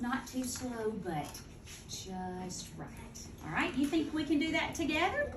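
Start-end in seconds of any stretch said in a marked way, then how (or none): 0.0-2.9 soft demo. Shown as a demonstration. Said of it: "they read at a good rate".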